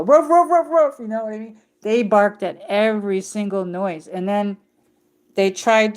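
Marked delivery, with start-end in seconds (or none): 0.0-1.0 high, loud yappy barks, each "Ruff" clipped short; mock dog barks. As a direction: bark like a small guard dog at a noise. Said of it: "They would go".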